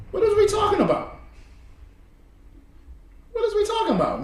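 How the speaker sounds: laughing disbelief. Real, not acted. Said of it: "repeated with laughter".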